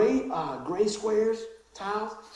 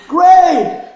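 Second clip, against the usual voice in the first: high, loud game call; playful game shout; 8 semitones higher, 15 dB louder than usual. Retold or imitated. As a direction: shout the colour. Hopscotch call retold.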